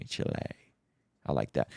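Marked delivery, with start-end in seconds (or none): 0.0-0.3 Chile said as "chih-LAY"; soft correction, Chile as "chih-LAY"; corrected pronunciation. Shown as a demonstration. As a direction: Chile ending in an "ay" sound. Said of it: "Excuse me, Chile".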